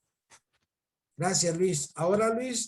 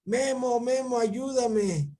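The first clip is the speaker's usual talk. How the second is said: imitated calling out; 4 semitones higher, slower than usual. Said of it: "hoy me gritó".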